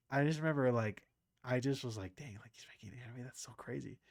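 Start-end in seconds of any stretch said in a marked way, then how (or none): 2.2-4.0 quiet awe. Retold or imitated. Quoting his own private amazement.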